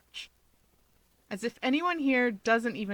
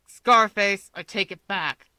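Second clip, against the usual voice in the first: snarling; 8 dB louder than usual. Acted. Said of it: "Bakugo snarls".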